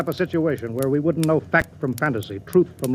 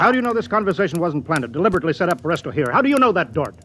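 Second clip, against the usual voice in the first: suspicious challenge; 5 semitones higher than usual. Acted. Captain challenges Dort suspiciously.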